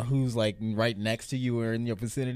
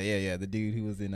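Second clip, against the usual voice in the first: ordinary delivery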